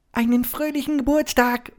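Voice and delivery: very high piping mouse voice; little mouse peeping a birthday wish; squeaking mouse. Acted. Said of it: "piepste sie".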